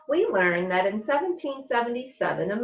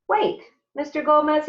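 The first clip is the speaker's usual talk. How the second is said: eager question; 8 semitones higher, 5 dB louder than usual. Acted. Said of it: "Charles raises his hand".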